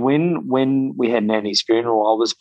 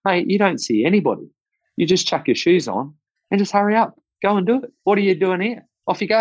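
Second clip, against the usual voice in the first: put-on higher, brisk, no-nonsense old man; Colin, brushing it off; 10 semitones higher, faster than usual. Old mentor telling the young trainer to get going, brisk and higher. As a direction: shoo him off with gruff impatience, a bit hammed up. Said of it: "Colin just said".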